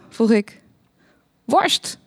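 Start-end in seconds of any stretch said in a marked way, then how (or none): 1.4-2.0 higher-pitched yelp at ordinary volume; mild narrated yell. A narrator's reading. Geert Wilders answers why they go to Hema, mock yell. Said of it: "gilde Geert Wilders".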